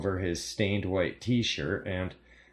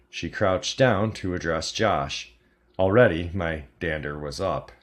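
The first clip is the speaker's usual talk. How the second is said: plain reading voice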